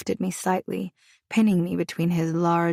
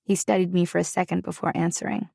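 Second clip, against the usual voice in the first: usual speaking voice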